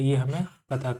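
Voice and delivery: ordinary delivery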